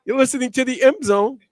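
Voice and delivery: building to a big, drawn-out station shout; high, loud announcer flourish at the end; radio announcer. Real, not acted. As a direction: sell the station name into the break. Host's station ident before break.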